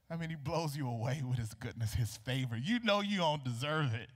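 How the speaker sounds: ordinary talk, nothing marked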